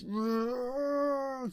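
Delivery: high, loud wookiee roar held long; a loud, high held creature howl; Chewbacca roar. Retold or imitated. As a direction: roar like a battle-mad wookiee. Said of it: "Chewbacca goes".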